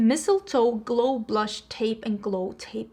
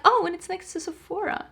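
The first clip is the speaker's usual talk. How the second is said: feigned surprise; 7 semitones higher than usual. Retold or imitated. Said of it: "I'm like".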